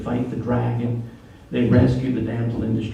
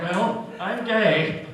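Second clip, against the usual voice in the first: deadpan reveal; 6 semitones higher, much slower than usual. Retold or imitated. Husband's deadpan punchline.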